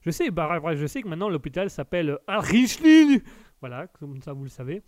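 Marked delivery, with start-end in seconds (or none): 2.3-3.2 Dutch accent. Shown as a demonstration. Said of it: "Ça, c'était mon accent, euh, néerlandais".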